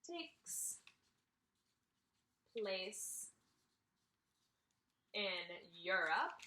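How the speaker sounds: each word drawn out, slow; slow dictation. Shown as a demonstration. Teacher dictating slowly while writing on the Venn diagram. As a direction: spell it out slowly as you write. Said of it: "slow dictation while writing".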